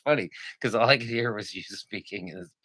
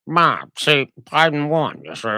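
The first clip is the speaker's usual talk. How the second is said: put-on old man voice; 5 semitones higher, 7 dB louder than usual. Mocking McConnell.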